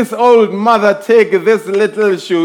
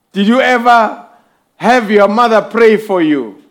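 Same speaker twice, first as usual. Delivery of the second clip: ordinary talk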